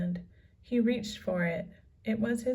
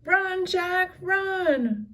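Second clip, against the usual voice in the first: high, loud desperate cry; cried out; 10 semitones higher, 6 dB louder, much slower than usual. A narrator's reading. Annie cries to Jack as pirates charge, high loud cry. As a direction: cry out for him to run. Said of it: "cried Annie".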